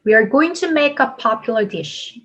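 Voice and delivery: slow model sentence, dropping on dish; falling intonation. Shown as a demonstration. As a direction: stress popular and let dish fall. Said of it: "내려갑니다". (dish에서 억양을 내려 천천히)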